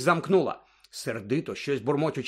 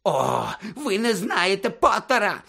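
high, drawn-out frantic wail; shrieking rage; 6 semitones higher, 3 dB louder, slower than usual. A narrator's reading. Snape insisting on Potter's guilt, shrieking. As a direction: screech it slowly, beside yourself. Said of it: "продовжував верещати Снейп".

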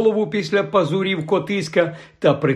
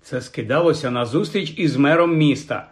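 usual speaking voice

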